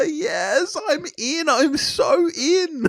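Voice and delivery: very high, laughing excitement; delighted squeal. Real, not acted. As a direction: squeal it out, stretching the last words. Delighted reaction to zombie reveal.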